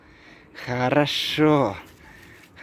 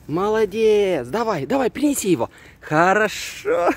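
high, bright praise for the dog; talking to a dog; 6 semitones higher, faster than usual. Real, not acted. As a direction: cheer the dog on, pitch up. Praising dog during fetch.

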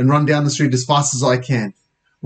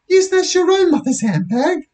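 put-on very high outraged girl's voice; shocked Lisa. Girl accusing boy of robbing his mother, high and shocked. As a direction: accuse in high-pitched disbelief. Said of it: "looking even more shocked".

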